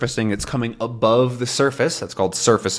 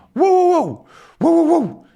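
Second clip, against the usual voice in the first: much higher, louder mock barks; dog barking; 6 dB louder than usual. Snowy barks as Tintin goes under, much higher. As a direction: bark like a small anxious dog. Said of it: "Snowy barking".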